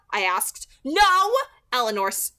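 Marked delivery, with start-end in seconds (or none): 0.8-1.4 loud, high snap; snapped. A narrator's reading. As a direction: cut him off hard. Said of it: "Edith snapped".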